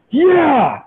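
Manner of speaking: a short high burst of triumph; fist-pump yeah, pitched high; excited yell. Real, not acted. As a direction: let it fly as the ball clears the wall. Pumping fist after home run.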